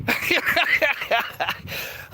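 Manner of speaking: amused cackle tailing off; real laughter. Real, not acted. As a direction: laugh at your own joke. Laughing at his own joke.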